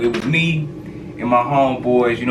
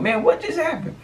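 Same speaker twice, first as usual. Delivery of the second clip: high baffled. Retold or imitated. Baffled after cops let them go, high disbelief. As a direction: squeak out your disbelief.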